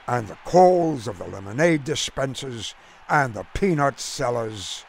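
ordinary delivery

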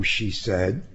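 usual speaking voice

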